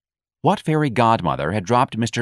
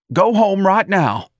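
high, loud, drawn-out command; sharp order; 12 semitones higher, 6 dB louder, slower than usual. A narrator's reading. Office manager sends elderly employee home, sharp and agitated. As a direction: order her out, slowly and insistently.

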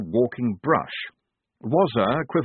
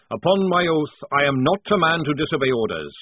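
a hearty barrack-room sergeant, raised and loud; sergeant's voice, raised and loud; gruff old sergeant; 7 semitones higher, 5 dB louder than usual. A narrator's reading. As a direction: play the old sergeant swearing blind. Sergeant Jackram speaking.

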